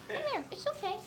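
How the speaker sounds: high soft coaxing call; cooing to cat. Real, not acted. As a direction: coax the cat softly, way up high.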